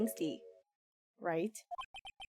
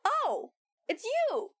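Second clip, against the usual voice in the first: much higher, flustered host apologizing; startled character voice; 11 semitones higher than usual. Acted. Game character greeting an unexpected guest, high and flustered. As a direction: squeak out the surprise and apology.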